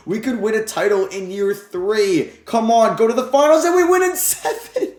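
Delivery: builds from hopeful to a high, loud cheer; rising excitement. Real, not acted. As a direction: let it climb into a shout of joy. Reaching the finals.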